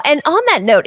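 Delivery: ordinary delivery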